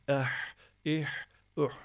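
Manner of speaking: "Eh, ih, üh" ending in a tight throat H; squeezed throat. Shown as a demonstration. Teacher repeating for students, constricted "Eh, ih, üh". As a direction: tighten the throat and push breath through each H. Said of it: "Boğazı sıkacağız".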